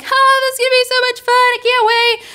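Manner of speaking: high, fast gushing; excited friends. Retold or imitated. Friends waiting in line, high-pitched excitement. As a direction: squeal with mock eager anticipation.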